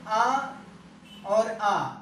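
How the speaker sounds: ये आ with a long held आ; slow stretched. Shown as a demonstration. Teacher pointing out the vowel to students, very slow. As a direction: hold the आ out long. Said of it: "teacher stretches the vowel".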